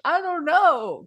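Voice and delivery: put-on high, loud, drawn-out toddler whine; whiny crying toddler. Mimicking a crying preschooler to a friend, high slow whine. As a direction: whine it like a crying small child. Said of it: "And they're just like".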